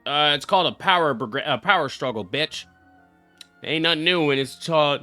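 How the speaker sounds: usual speaking voice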